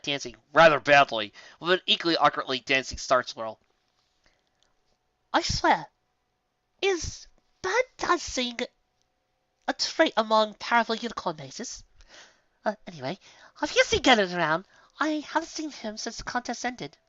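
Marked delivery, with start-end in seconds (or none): 5.3-17.0 amused, then curious; lightly voiced posh unicorn chatting; Rarity's voice. A narrator's reading.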